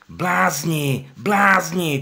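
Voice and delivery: donkey screaming at the crowd; shouting. Acted. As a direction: yell at them in exasperation. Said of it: "křičel Benjamin".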